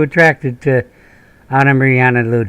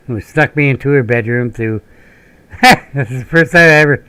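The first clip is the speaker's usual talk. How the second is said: ordinary talk, nothing marked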